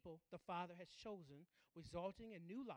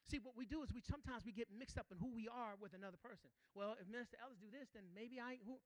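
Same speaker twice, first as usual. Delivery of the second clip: normal talking voice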